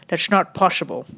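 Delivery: put-on comic Dutch accent refusal. Comedian quoting the phrase heard over and over, Dutch accent. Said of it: "my Dutch accent is so amazing".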